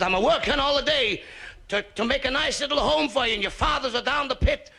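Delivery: put-on high-pitched Italian-accented maternal tirade; mock Italian accent. Mother pleading with her scrapping son, Italian-accented scolding. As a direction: lay on the hard-done-by Italian mama. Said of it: "You're not Italian".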